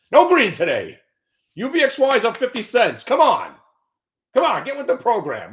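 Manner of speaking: loud, high urging; shouting. Real, not acted. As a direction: yell at the ticker to move. Shouting at the market.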